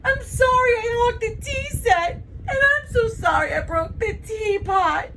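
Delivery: put-on high, loud crying child; hammed-up, wailing remorse; tearful apology. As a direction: blubber out an apology to your best friend. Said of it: "Bree starts crying too then".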